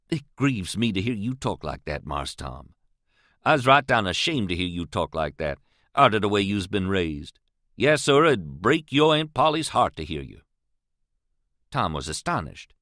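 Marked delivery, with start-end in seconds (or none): none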